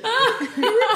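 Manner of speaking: loud high laugh; real laughter burst. Real, not acted. Cracking up at the memory.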